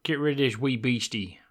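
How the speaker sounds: Scottish lilt on a shopkeeper's scolding; Scottish brogue, shooing a beast; Scottish accent. Retold or imitated. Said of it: "weird, like, Scottish accent".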